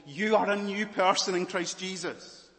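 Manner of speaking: usual speaking voice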